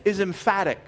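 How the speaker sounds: normal talking voice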